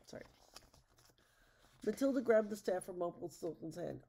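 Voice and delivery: usual speaking voice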